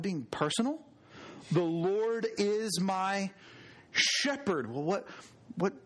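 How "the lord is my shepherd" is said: slow build, pause, then SHEPHERD; stress on SHEPHERD. Shown as a demonstration. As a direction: hold back, then land firmly on "shepherd". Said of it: "emphasize each word".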